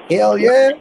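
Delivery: loud, high Southern whoop; Southern accent. Pretend. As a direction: holler "Hell yeah" like a Southern good old boy. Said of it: "I like talking like a Southern folk".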